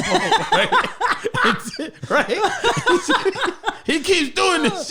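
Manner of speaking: high cracking laughter through the words; words squeezed out through laughter; laughing hard. Real, not acted. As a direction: laugh helplessly while telling it. Laughing hard telling story.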